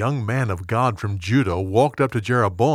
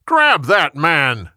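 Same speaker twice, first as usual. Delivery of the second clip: slow, loud, raised-pitch order; yelled command; 4 semitones higher, 5 dB louder, much slower than usual. A narrator's reading. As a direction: shout it as a king ordering an arrest, each word drawn out. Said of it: "Jeroboam yelled".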